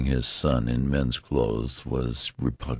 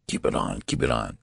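low, hurried urging; 7 semitones lower, faster than usual. A narrator's reading. Father telling his son to keep blanket on, hurried. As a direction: urge it hastily, pitched down. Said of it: "said Mister Button hurriedly".

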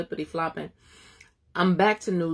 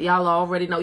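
normal talking voice

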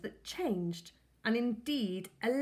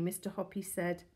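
plain reading voice

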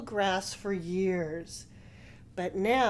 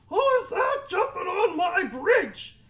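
high, loud troll shout; 11 semitones higher, 8 dB louder than usual. A narrator's reading. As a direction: yell the challenge as a troll. Troll challenges biggest goat.

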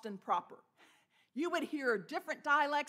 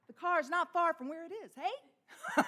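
New England lilt, jumping high on "hey"; imitated New England accent, brisk and lifted; 5 semitones higher, 5 dB louder than usual. Shown as a demonstration. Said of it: "if you're a Mainer, they go up".

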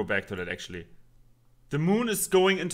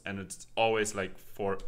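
ordinary talk, nothing marked

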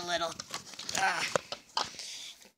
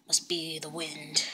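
hushed robber whisper; 8 semitones lower, 11 dB quieter than usual. Acted. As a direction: breathe it out secretly. Robber talking in the car.

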